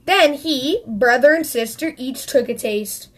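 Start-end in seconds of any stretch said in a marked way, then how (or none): none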